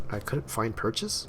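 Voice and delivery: slow, rising, unsure of the wording; doubtful reread, lifting at the end; puzzled questioning. Real, not acted. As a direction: read it slowly as a question.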